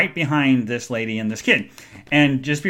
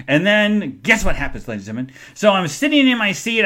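ordinary talk

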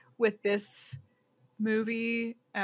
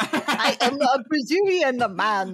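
slow, loud hammed-up Russian; mock Russian accent; 3 semitones higher, 6 dB louder, slower than usual. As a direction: play along as a Russian spy. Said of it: "a Russian accent".

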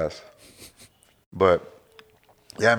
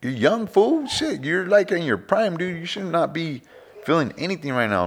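put-on higher, scolding the young guy; playful exasperation, pitched up; mock scolding; 9 semitones higher, slower than usual. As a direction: tease him for complaining. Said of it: "I'm like".

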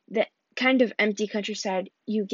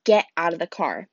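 deadly serious; 5 semitones lower than usual. Acted. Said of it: "deadly serious".

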